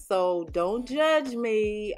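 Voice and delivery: ordinary talk, nothing marked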